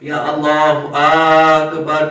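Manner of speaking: اکبر begun on a long loud 'Aaak-bar'; wrong long alif in Akbar. Retold or imitated. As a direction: imitate the caller dragging the first A of اکبر. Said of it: "کر دیتے ہیں". (مکبر کی طرح اکبر کا الف کھینچ کر)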